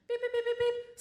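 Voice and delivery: high beeping imitation; metal detector beeps. Imitating the guard's detector going off. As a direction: beep like a hand scanner.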